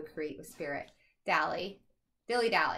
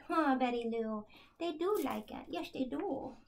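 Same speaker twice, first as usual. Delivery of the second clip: Southern accent; 3 semitones higher than usual. Real, not acted. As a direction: coo to the dog with a Southern lilt. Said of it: "Southern accent".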